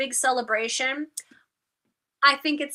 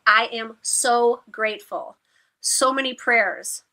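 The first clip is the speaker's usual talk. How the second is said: ordinary delivery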